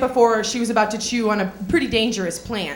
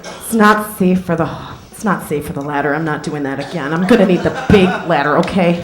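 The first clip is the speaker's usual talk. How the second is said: mock store clerk; 6 semitones lower than usual. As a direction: play the fussy clerk calling for a ladder. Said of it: "he goes".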